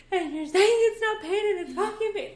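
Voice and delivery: high, loud hammed-up meltdown; mock going off. Reenacting going off at Luis, high loud mock outburst. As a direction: rant as if in a tearful rage. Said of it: "Like, going off".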